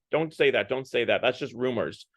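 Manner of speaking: normal talking voice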